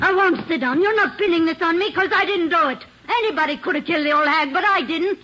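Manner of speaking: angry denial. Acted. Accused wife to the detectives, angry insistent denial. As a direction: snap back, insisting on innocence. Refuses to sit, denies killing.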